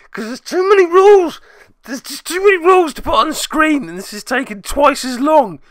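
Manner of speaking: high, loud whiny complaint voice; mock exasperation. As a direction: whine it in a squeaky put-upon voice. Mock exasperated complaint about rules.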